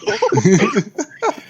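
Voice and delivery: loud high burst of laughter; laughing hard. Real, not acted. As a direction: crack up, pitched high. Laughing at the dojo line.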